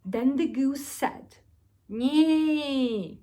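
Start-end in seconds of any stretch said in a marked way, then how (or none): 1.9-3.2 higher loud neigh; loud horse cry. Acted. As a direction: neigh with all her might. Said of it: "with all her might".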